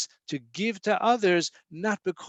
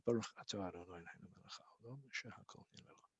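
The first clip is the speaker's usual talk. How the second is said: rapid, near-silent murmured blessing under the breath; muttered blessing; 7 semitones lower, 18 dB quieter, much faster than usual. Real, not acted. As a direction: rush the blessing almost soundlessly, to himself. Quick blessing after excusing himself.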